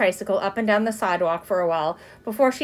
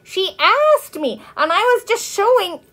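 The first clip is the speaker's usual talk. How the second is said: high, loud, drawn-out protest; indignant self-defense, pitched way up; protesting child; 11 semitones higher, 5 dB louder, slower than usual. A narrator's reading. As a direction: plead your case as a little girl. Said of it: "protested Ramona as she was dragged".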